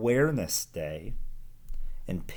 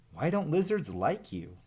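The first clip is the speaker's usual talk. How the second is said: put-on higher curious young snake; lightly voiced curious hatchling; Verdi asking; 6 semitones higher than usual. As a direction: ask it eagerly like a small snake. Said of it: "asked Verdi".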